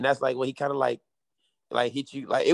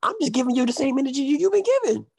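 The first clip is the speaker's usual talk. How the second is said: heated retort; 9 semitones higher than usual. Retold or imitated. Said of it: "I said".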